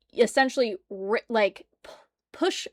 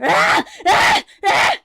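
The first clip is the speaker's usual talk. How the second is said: loud, higher zombie shrieks; zombie screams; 10 semitones higher, 8 dB louder, slower than usual. Acted.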